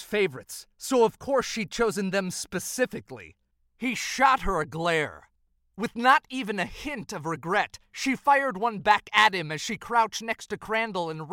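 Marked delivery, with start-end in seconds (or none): none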